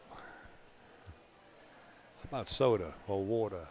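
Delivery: New York soda and water; New York accent. Shown as a demonstration. Commentator offers sample words, heavy New York accent. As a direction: lean into the New York vowels. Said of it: "people don't say I got an accent".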